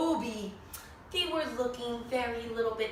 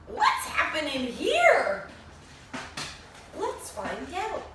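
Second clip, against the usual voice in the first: mock suspense; 3 semitones higher than usual. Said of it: "suspense question to children".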